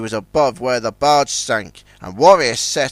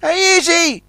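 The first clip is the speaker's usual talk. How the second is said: high calling yell; 13 semitones higher than usual. Acted. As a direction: call out across the water. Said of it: "yelled Ten Cents".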